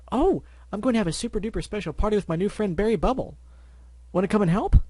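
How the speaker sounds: high, eager party invite; bubbly Pinkie. Acted. Pinkie inviting Applejack to her party, bubbly. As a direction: gush like an excited party pony.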